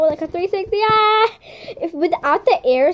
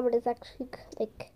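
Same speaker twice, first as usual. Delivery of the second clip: whisper; 9 dB quieter than usual. Real, not acted. Telling a secret aside.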